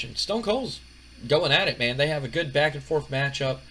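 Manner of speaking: normal talking voice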